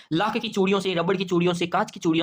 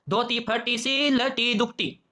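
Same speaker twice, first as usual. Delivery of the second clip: slow, higher poem recitation; 4 semitones higher, much slower than usual. Acted. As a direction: draw each word out like a verse. Reciting a poem line slowly. (धीमा, ऊँचे सुर में कविता-पाठ)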